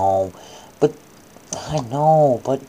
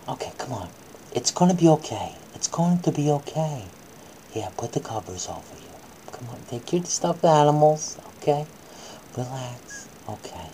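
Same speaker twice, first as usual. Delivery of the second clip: soothing; 2 semitones lower, 4 dB quieter than usual. Acted. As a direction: settle the child down gently. Tucking child into bed.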